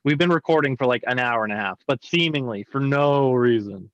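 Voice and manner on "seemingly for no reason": "seemingly for no reason" slowly drawn out with a long "no"; mocking echo. Real, not acted. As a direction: throw it back slowly, stretching "no". Repeats the mocking echo for emphasis.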